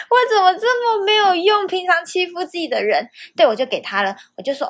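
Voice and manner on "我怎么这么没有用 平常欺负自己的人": half-joking whimper. Real, not acted. Mock-crying to viewers about her own weakness. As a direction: wail at her own softness, half laughing. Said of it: "我竟然就拿卫生纸给她了".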